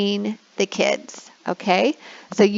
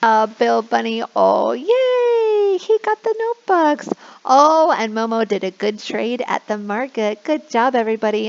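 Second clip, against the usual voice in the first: excited squeal; 3 semitones higher than usual. Shown as a demonstration. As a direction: squeal with joy for kids. Cheering in animation.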